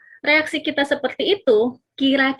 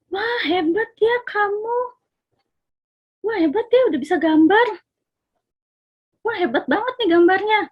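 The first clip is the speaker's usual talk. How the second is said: over-the-top praise; 10 semitones higher than usual. Retold or imitated. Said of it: "Lebay banget nih Mama".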